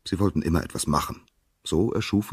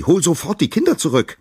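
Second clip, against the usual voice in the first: high, loud horrified scream; appalled mother shrieking at her husband; 13 semitones higher, 8 dB louder than usual. A narrator's reading. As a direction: scream it at him in disbelief. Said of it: "schrie sie".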